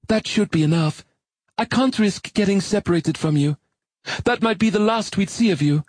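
high, brisk female character voice; woman's voice. Acted. Molly lashes them together.

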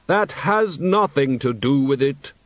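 slow, hollow, solemn deathly voice; Death's voice. Acted. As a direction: speak as grave, patient Death. Death speaks.

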